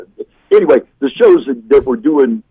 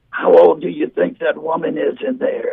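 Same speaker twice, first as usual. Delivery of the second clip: higher-pitched imitated old man; elderly man; 4 semitones higher, 4 dB quieter than usual. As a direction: quiz someone as a curious old man. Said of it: "eighty, late eighties".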